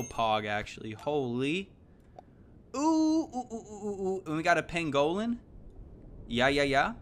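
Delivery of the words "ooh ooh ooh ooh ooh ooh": high excited ooh fading off; excited cooing. Real, not acted. Gamer spotting a pangolin in the shop, cooing excitedly. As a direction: coo eagerly at a good find.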